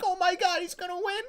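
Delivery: very high, thrilled squeal; high-pitched fan joy; disbelieving excitement. Retold or imitated. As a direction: gasp it like a fan who can't believe it. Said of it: "and we're like".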